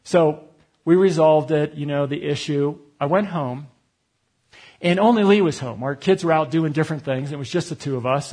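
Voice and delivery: normal talking voice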